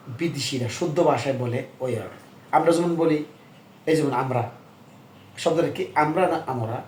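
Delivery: usual speaking voice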